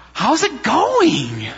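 high, slow, drawn-out "how's it going"; wife's question. Retold or imitated. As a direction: play the wife checking in gently. Voicing wife on phone.

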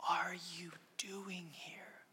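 slow, hushed whisper with pauses; gentle whisper. A narrator's reading. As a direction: whisper it slowly, as a mysterious voice. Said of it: "Then a voice said to him".

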